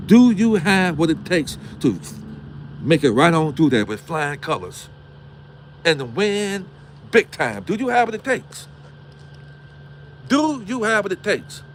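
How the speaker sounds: high, rising preacher cadence that builds; preaching at full force. Acted. Host challenging his viewers to toughen up, preaching hard. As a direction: hammer the challenge home at the crowd.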